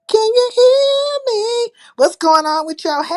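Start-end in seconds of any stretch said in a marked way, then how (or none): 0.1-1.7 sing-song call. Real, not acted. Checking the stream audio.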